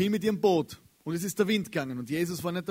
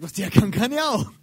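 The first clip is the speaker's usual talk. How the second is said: louder, higher, cocky challenge; cocky Peter; 5 semitones higher, 10 dB louder than usual. Retold or imitated. Preacher voicing Peter seeing Jesus on the water, loud and cocky. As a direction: boast like a bold fisherman who can do it too. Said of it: "und sagt".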